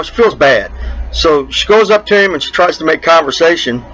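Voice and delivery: usual speaking voice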